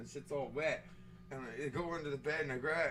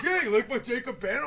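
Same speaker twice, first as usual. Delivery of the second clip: higher, louder laughing imitation of a friend; oblivious friend; 8 semitones higher, 8 dB louder than usual. Friend showing off his print, mock oblivious. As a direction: lightly mimic a pleased, clueless pal.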